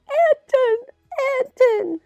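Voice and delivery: very high, loud screamed name; yelling a name. A narrator's reading. Woman next door yelling for Anton, high loud scream. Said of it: "The woman next door was yelling".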